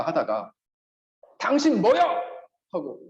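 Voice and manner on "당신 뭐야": mock angry challenge, higher and louder; sudden rude retort. Pastor acts out a polite talk turning rude, mock angry. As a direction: snap it like a man losing his temper. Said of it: "이렇게 욕을 했다".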